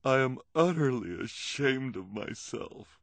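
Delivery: slow, high, tearful confession; through tears. Acted. Olivia confessing through tears to the children. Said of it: "through her tears".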